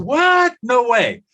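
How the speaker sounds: high, loud cry of disbelief; "What? No way" as a burst of astonishment; stunned disbelief. Retold or imitated. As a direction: act out the jaw-dropping shock of the workshop crowd. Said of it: "They say".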